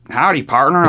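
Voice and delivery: a hint of a hammed-up country accent; lightly put-on cowboy greeting, teasing; mock cowboy drawl. Answering Tennessee locals with a mock cowboy howdy. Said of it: "And I'm just like".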